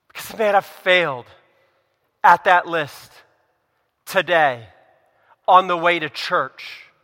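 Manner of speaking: ordinary delivery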